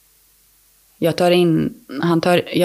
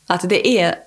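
usual speaking voice

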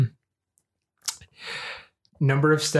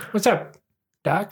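Bugs Bunny voice; 6 semitones higher, slower than usual. Retold or imitated.

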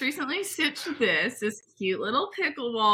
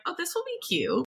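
put-on bubbly sorority girl; 8 semitones higher than usual. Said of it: "and so I was like".

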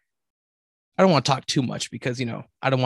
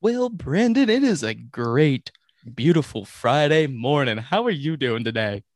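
news anchor voice; slower than usual. Acted. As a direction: read it like a cheerful morning anchor. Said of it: "morning news anchor voice?".

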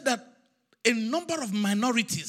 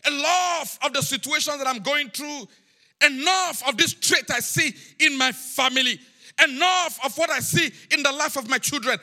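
insistent preached cry to God; pleading prayer; 5 semitones higher, 3 dB louder than usual. Acted. Believer demanding change from the Lord, pleading prayer. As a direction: press each "enough" as a demand on the Lord. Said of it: "staying with God and saying".

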